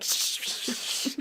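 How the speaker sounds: hushed, voiceless shushing; teachers' shushing. Retold or imitated. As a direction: shush a rowdy room like teachers. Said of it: "all the teachers, like".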